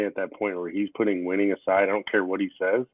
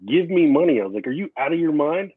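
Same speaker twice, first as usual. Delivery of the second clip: outraged rant; 6 semitones higher than usual. Retold or imitated. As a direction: keep ranting much higher, incredulous. Retelling his outburst to wife.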